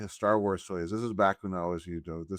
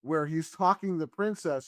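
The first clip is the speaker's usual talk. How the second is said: ordinary talk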